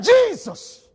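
high loud call of Jesus; Bartimaeus crying out. Acted. Blind beggar calls out, a high loud cry. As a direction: call out to him from the roadside. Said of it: "he began to cry".

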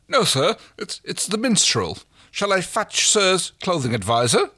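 high, lightly voiced timid clerk; nervous shop assistant. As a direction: play the flustered shop clerk. Assistant voice, held.

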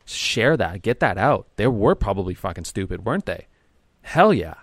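loud, hyped cheering on; hyped-up encouragement. Shown as a demonstration. Hyping up a friend to vent their anger, loud and fired up. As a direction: pump them up to let it all out. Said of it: "That's what I mean by I'm gonna ramp you up and amplify it".

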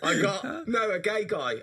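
high, loud, giggly false start; laughing. Real, not acted. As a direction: start the story through laughter.

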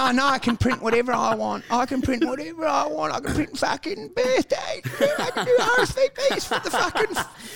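high mock-proud shop worker, near shouting; a mock-grand retail employee boasting about printing; deluded Officeworks worker. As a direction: brag about printing like it is glory.

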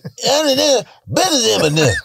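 high, garbled [name] grunts; [name] impression. Singer rambling at the fight, high and garbled. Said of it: "[name] was like".

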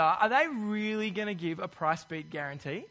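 normal talking voice

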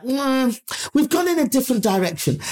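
high, noncommittal executive brush-off; mock polite rejection. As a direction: imitate a squeamish, high-voiced let-down. Executives retract their earlier praise.

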